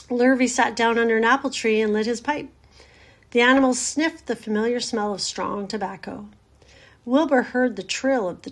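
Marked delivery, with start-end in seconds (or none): none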